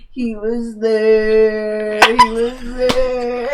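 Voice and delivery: put-on very slow, drawn-out drunk mumble; mumbling. Barely conscious drunk rambles about a guy, slow woozy mumbling. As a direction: drag the words out as if barely conscious. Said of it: "then I started mumbling".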